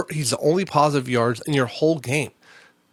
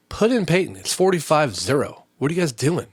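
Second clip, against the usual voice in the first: raised-pitch, mildly exasperated challenge; retold exasperated demand; 5 semitones higher, 4 dB louder than usual. Parent to coaches while losing forty-five zero, retold exasperation. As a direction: replay a parent arguing with the coaches. Acted sideline argument with coaches.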